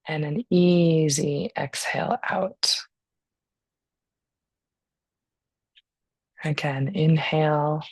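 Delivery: calm, unhurried breathing guidance; guided meditation. Real, not acted.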